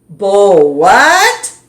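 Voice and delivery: loud, baffled; can't believe that word; puzzled girl. Acted.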